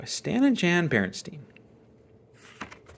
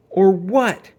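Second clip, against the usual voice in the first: loud, higher mocking challenge; sneer; 8 semitones higher, 9 dB louder than usual. Bully taunting the brother, loud sneer. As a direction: sneer the dare back at him. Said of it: "sneered Too Tall".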